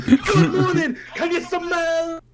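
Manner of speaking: high, loud wrestler catchphrase, stretched out at the end; wrestling promo. Acted. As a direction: bellow it like a wrestler working the crowd. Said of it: "Everything is a wrestling promo".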